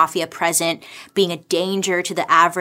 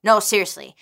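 ordinary delivery

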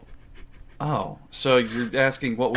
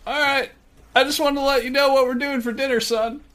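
much higher chummy dad voice; a high, jokey dad praising his son; [name]'s dad impression; 10 semitones higher than usual. Dad impressed by son's Australian friend, much higher. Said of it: "that's scarily accurate".

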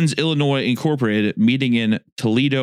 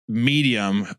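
ordinary delivery

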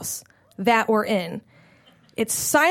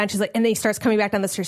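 ordinary talk

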